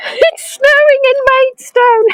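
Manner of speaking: laughing through the words; giggling at the silly snow. Real, not acted. Tour guide laughing to viewers about the snow.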